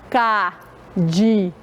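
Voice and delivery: "Cadeado" broken into ca, de, a, do; syllable by syllable. Shown as a demonstration. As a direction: demonstrate four slow, separated syllables in "Cadeado". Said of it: "Quantas vezes fizemos o movimento?".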